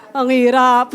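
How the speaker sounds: amused, giving way to laughter; laughing admission. Real, not acted. Preacher admitting gentle talk is hard, laughing. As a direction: say it breaking into a laugh.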